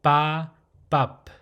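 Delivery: "ba" held open, "bop" shut on the lips; open then closed. Shown as a demonstration. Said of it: "how you pronounce the closed phonetic".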